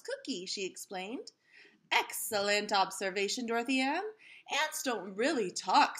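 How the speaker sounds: lively storybook read-aloud voice; reading to children. A narrator's reading. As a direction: read it like story time for a class. Reads picture book aloud to kids.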